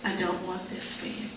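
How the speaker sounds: hushed, tender plea; soft divine plea. Retold or imitated. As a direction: say it softly, like a loving father. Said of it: "he was pleading with me".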